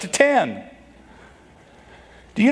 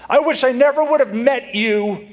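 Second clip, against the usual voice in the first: high-pitched hurtful jab; mock marital outburst; 9 semitones higher than usual. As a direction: act out a spouse lashing out. Acted hurtful marital quarrel line.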